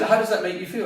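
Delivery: teasing concern; mock therapist question. Retold friend asks how he feels.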